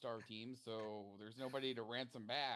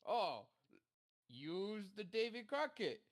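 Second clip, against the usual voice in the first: goading quote; 8 semitones higher, 4 dB louder, slower than usual. Retold or imitated. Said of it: "it would be like".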